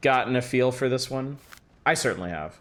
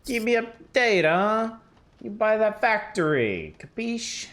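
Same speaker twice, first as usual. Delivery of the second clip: a hammed-up Italian mobster accent, higher; mocking the boss in an Italian mobster accent; 8 semitones higher than usual. Streamer mocks the boss in an Italian mobster accent. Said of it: "That accent sure was an accent".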